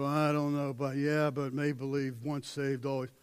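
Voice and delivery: low, soft monotone mumble; droning, noncommittal mock voice; mock doubter. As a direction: imitate someone doubtfully running phrases together.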